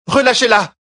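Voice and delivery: high, loud woman's command; demanding cry. A narrator's reading. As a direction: order them to let her go.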